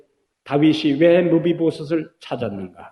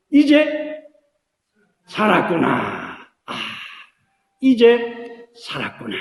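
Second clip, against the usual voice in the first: relieved sigh; 4 semitones higher, 3 dB louder, slower than usual. Acted. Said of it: "무비보셋의 마음속에 어떤 생각이".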